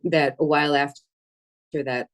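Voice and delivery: ordinary talk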